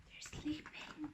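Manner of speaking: very soft, breath-only whisper; hushed whisper. A narrator's reading. Anna finds her grandparents asleep, whispering. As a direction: whisper so as not to wake them.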